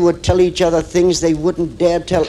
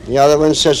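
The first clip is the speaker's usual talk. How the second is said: ordinary talk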